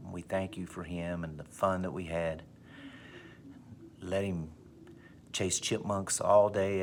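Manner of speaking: soft, hushed graveside prayer; mock solemn prayer. Dad praying over the buried cat, soft and solemn. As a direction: pray quietly like at a real funeral.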